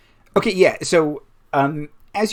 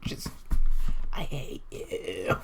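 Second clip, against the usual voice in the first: very soft, slow, voiceless groan; weak, drawn-out near-whisper; hushed resentment; 6 dB quieter, slower than usual. Acted. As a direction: squeeze it out slowly under your breath. Hurt character grumbling at the player.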